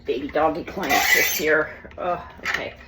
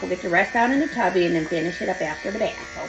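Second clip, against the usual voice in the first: ordinary talk, nothing marked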